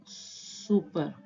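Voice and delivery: Super drawn out slowly and softly, syllable by syllable; slow read-out. Shown as a demonstration. Teacher reading the finished outline to students, slowly. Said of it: "तो ये बन जाएगा".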